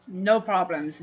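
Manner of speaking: ordinary talk, nothing marked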